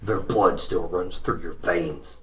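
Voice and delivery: low, quiet bloodthirsty brute; menacing ogre voice. Acted. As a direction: threaten softly like a brute.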